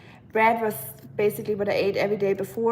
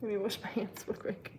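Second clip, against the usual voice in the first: ordinary talk, nothing marked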